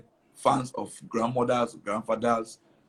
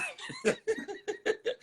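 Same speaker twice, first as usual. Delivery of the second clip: high laugh; 7 dB quieter than usual. Real, not acted. Laughs at own joke.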